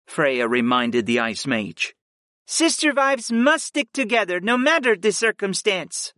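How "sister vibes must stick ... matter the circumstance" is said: higher woman's voice; female character voice. Acted. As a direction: voice Freya, pitched up. Freya voiced.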